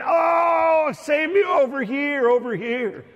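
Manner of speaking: high, loud shout for rescue; drowning cry. Acted. Drowning swimmer to a boat captain looking away, desperate acted shout. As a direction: yell to catch a distant captain's attention. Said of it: "like you're drowning".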